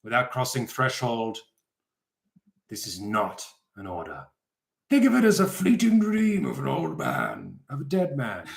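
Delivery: rising into a grand proclamation; dramatic old man reading. Acted. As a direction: read it like a dying old master's decree. Reads Netero's letter dramatically.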